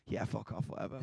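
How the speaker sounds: quiet, breathy brush-off; half-whispered yeah, fuck off; dismissive brush-off. Retold or imitated. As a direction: brush off a prank call. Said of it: "I'm like".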